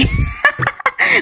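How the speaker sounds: short, high giggle; high laugh at own mistake; amused laugh. Real, not acted. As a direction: burst into soft, high-pitched giggles. Laughs at repeated slip.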